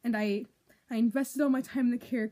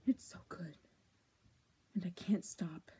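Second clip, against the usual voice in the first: hushed; 5 semitones lower, 9 dB quieter than usual. Real, not acted. Fading confession.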